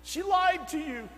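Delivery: put-on pleading it out slowly, as the upset young man; tearful confession. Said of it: "He said".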